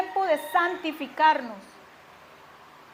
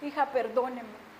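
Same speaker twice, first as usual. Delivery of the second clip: soft, lowered voice after yelling; gentle, subdued apology; retold contrite apology; 3 semitones lower, 7 dB quieter than usual. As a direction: ask forgiveness quietly. Yelling mother at once apologizes to daughter.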